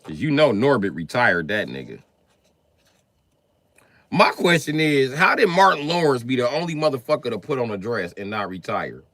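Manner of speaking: ordinary talk, nothing marked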